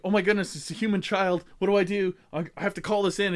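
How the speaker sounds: high, fast flustered elf voice; a nervous little elf panicking over a human child; panicky elf. Retold or imitated.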